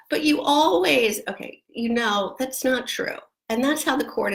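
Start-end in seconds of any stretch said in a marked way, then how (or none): 0.1-1.2 a higher, louder mock childish protest; whiny child. Mother imitates her kid arguing back, whiny and higher. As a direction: play the kid complaining, pitched up. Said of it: "your kid comes to you and goes".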